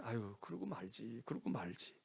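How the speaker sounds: low, soft, slow brush-off; dismissive neighbor. Acted. Neighbor lady shrugging off someone else's child, resigned. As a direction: let it go in a slack low murmur. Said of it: "동네 아줌마 같으면 그냥".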